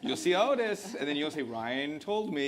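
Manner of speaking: high, loud, teasing delivery; laughing. Real, not acted. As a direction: call it out through laughter, slowing at the end. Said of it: "I'm laughing".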